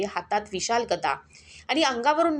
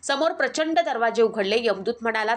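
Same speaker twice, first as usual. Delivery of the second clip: ordinary delivery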